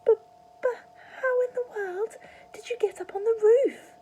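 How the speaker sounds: high, breathless whispered cry; high, airy frightened cry. A narrator's reading. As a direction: gasp it out in disbelief. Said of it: "cried the mother".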